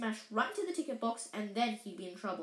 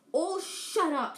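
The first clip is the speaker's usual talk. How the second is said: slow, louder, higher exasperated snap; irritated; 6 semitones higher, 6 dB louder, slower than usual. Acted. Prefect brother fed up with the twins' teasing, exasperated.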